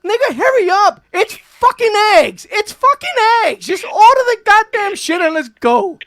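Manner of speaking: high, loud impatient snapping; impatient Gail. Retold or imitated. Gail rushing him to order at IHOP, high loud impatient snap. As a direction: mimic Gail bossing him to order fast. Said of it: "she goes".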